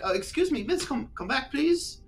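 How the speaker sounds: high, pleading falsetto of a fussy lord; lord calling after her. Acted. Lord calling the girl back up the stairs, high and pleading. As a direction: call her back, rising and desperate. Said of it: "and he's like".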